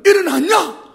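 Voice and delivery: high, loud call, slow at the end; calling to daughter. Retold or imitated. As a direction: call across the webcam cheerfully. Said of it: "뭐 이렇게 하고요".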